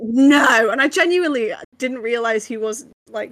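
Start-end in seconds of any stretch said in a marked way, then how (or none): 0.0-0.7 loud, drawn-out cry of disbelief; mock-dismayed, louder reply; disbelieving no. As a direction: retell your stunned, emphatic denial. Retelling shocked reply to parents.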